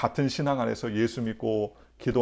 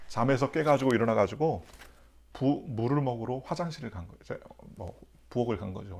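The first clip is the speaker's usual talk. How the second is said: ordinary talk, nothing marked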